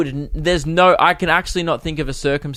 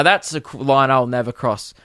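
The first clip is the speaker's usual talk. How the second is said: usual speaking voice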